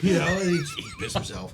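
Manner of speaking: ordinary talk, nothing marked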